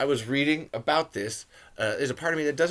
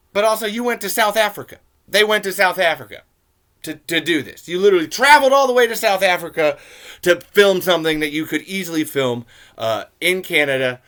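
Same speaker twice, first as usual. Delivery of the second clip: exasperated; 5 semitones higher, 7 dB louder than usual. Real, not acted. Exasperated complaint about location.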